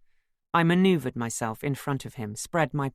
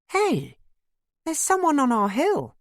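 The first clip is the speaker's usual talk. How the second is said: high, loud startled call; indignant surprise; 13 semitones higher, 6 dB louder than usual. Acted. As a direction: exclaim it high, pointing out an intruder. Wife spots a stranger, points accusingly.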